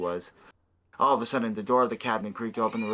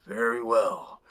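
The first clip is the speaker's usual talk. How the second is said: creepy voice; slower than usual. Acted. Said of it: "what a creepy voice".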